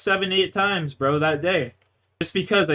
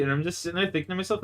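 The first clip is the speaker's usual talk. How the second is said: usual speaking voice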